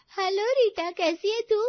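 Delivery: high, loud cheerful greeting; perky friend. Acted. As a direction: greet your friend brightly on the phone. Friend greets Rita on phone.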